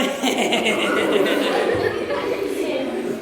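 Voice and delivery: mischievous put-on cackle; drawn-out mock snickering; secret gleeful giggle. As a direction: giggle like a triumphant little brother. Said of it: "but inside he's going".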